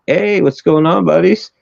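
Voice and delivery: high-pitched, acted friendly hail; chummy greeting. Teller voicing the skier hailing Finnish soldiers, chummy. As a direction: imitate a cheery call to pals.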